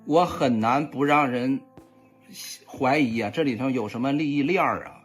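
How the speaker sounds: normal talking voice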